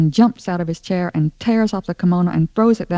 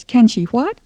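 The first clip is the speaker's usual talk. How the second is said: high, loud incredulous question; calling back; 5 semitones higher, 6 dB louder, slower than usual. Acted. Stella Rondo from upstairs to mama, calling back. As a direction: holler it back down the stairs.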